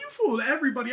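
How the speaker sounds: loud, high-pitched retold outburst; indignant accusation. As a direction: throw the accusation at her loudly. Retold quarrel, accusing her.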